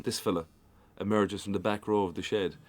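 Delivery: ordinary talk, nothing marked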